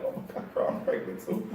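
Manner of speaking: hushed unvoiced whisper; confiding whisper, barely audible; whispered aside. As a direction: drop to a secret whisper. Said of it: "I was feeling like".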